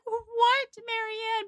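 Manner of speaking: high-pitched exasperated disbelief; acted-out exasperated sister. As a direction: react in high, incredulous exasperation. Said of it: "She's exasperated".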